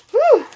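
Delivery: high laugh. Real, not acted. Laughs at viewer's reaction.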